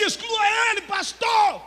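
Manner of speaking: pedido gritado e agudo; grito imitado. Retold or imitated. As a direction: grita exigindo a exclusão. Jovem pede exclusão, citada. (grito agudo de jovem exigindo)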